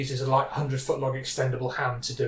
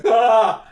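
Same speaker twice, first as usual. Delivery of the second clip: loud, high mock scream of panic; panicked cry; 6 semitones higher, 9 dB louder than usual. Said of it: "Dennis goes".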